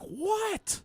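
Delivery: high, shocked "What?"; baffled squeak. Retold or imitated. Teen sister, baffled by her mom, high shocked squeak. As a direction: squeak it out, totally lost.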